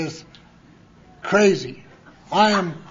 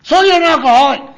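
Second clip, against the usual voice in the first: put-on much higher and louder, the old man shouting; shouting old-timer confronting a newcomer; angry shout; 8 semitones higher, 12 dB louder than usual. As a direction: bark it at him in front of everyone. Nick jumps up shouting.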